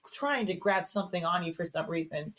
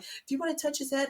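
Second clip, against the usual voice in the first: imitating midwife; 8 semitones higher than usual. Imitating midwife's sweet offer.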